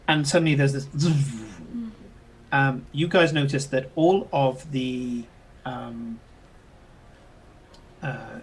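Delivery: normal talking voice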